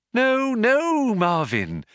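high, sing-song reassurance; humouring, musical soothing; lilting. A narrator's reading. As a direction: lilt it with forced brightness. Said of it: "lilted Trillian".

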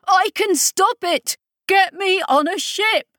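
high, loud frantic shout; desperate shouting. A narrator's reading. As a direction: shout it over the crowd. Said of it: "Idris stopped shouting".

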